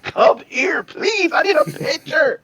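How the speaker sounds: very high, loud pleading shriek; retold scream. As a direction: beg at a screaming pitch like a desperate fan. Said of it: "and I screamed out".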